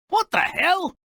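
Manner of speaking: high, disbelieving "what the hell"; shocked outburst. Real, not acted. Narrator reacting to the parents allowing the suitor, shocked. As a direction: blurt it out in shock.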